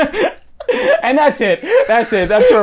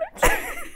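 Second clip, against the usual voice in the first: high squeak of laughter; 4 dB quieter than usual. Real, not acted. Laughing at own Bitcoin joke.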